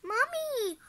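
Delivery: put-on high drawn-out child's wail. A narrator's reading. Ross calling to Mom, high wail. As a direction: wail for mom like a little kid. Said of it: "Ross wailed".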